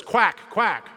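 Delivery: high, loud duck quack. Acted. First duck in a joke, high loud quacking. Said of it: "the first duck says".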